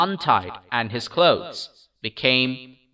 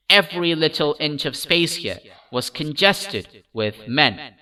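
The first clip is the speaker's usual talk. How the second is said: plain reading voice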